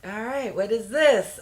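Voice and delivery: ordinary delivery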